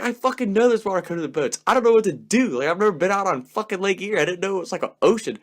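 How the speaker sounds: high, loud, fast frantic retort; panicking driver snapping at the passengers; panicked snapping. Retold or imitated. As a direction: snap back in panic. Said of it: "And I'm like".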